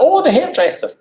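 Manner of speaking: high, loud comic gush; gushing realization. Retold or imitated. Hairdresser greeting royal visitors, high gushing realization. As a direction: squeal it in mock delight.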